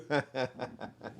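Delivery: short real laugh, fading. Real, not acted. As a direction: let a real laugh slip out. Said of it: "I'm not laughing at you".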